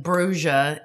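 drawn-out "Bruja" as broo-zha; slow "Bruja", said broo-zha; BRUJA with a zh sound. Retold or imitated. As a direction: mimic their "Bruja", zh in place of H. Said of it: "Which they keep calling".

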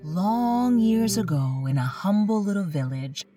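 plain reading voice

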